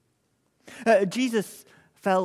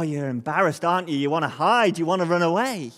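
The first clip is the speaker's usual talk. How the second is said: normal talking voice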